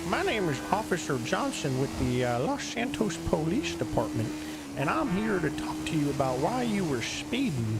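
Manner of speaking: high-pitched southern police officer; southern twang. Shown as a demonstration. Officer tells a driver why he stopped them, southern twang. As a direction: play a southern cop with a swooping twang. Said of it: "He's got this southern twang".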